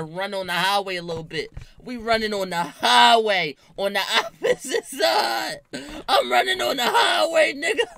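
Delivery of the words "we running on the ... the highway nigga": louder, higher words through laughter; laughing. Real, not acted. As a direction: tell it while cracking up. Laughing while telling the run.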